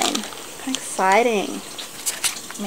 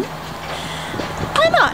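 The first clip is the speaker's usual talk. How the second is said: playful squeak. Real, not acted. Stalking the delivery driver.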